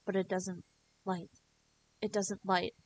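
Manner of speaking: plain reading voice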